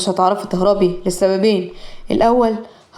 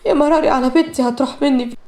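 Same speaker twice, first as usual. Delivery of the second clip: يا مراري said at normal pace, only a bit higher; tearful lament; 4 semitones higher than usual. Acted. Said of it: "فاطمة بعياط شديد".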